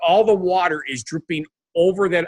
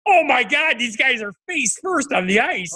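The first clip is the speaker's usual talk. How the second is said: shocked; 9 semitones higher, slower than usual. Retold or imitated. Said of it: "You're like".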